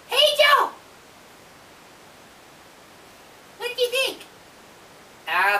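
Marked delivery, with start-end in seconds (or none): 0.1-4.2 squeaky raccoon voice. Pretend. Lucky calls out.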